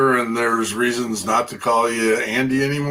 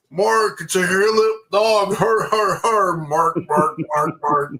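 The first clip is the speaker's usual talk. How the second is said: higher hammed-up old geezer joke voice; corny old man joke; 8 semitones higher than usual. Mocking an old-timer's dad joke, hammed-up. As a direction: ham up a corny old man's pun. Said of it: "old person that walked into your world that said".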